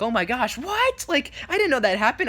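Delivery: high, hammed-up disbelief; pretend shock. Spider-Man reacting to a kid's news, mock shocked. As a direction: play astonished to amuse the kids. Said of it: "I was pretending to be like".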